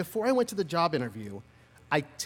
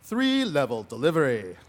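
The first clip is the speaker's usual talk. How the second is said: BBC delivery; 3 semitones lower than usual. Shown as a demonstration. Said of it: "they call it".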